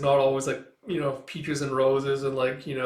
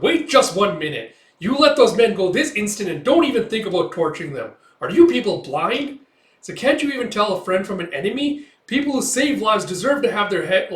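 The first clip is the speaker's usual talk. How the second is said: loud, higher scolding rant; angry scolding; 7 semitones higher, 8 dB louder than usual. Retold or imitated. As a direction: tear into the soldiers. Women storm forward scolding soldiers.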